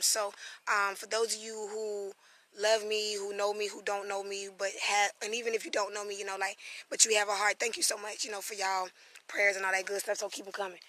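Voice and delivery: ordinary talk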